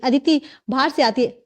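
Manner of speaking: ordinary delivery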